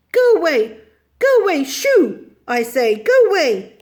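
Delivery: loud, high shooing shouts; indignant, insistent cries; angry. A narrator's reading. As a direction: order the dog off angrily. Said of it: "waving her arms angrily".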